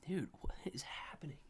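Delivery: voiceless whisper of disbelief; disbelieving whisper. Real, not acted. Streamer watching prices crash, whispering in disbelief. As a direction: whisper it as if stunned.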